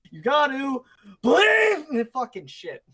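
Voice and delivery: high, loud strained shout, words stretched apart; hammed-up speech of a man on fire, breaking up each word; broken-up inspirational speech. Friend imitating a burning man's inspirational speech, shouted word by word. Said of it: "breaking every word into, like, its own".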